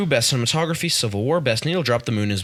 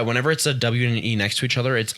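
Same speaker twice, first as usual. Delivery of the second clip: usual speaking voice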